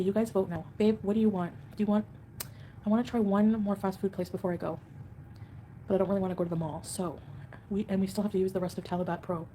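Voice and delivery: ordinary delivery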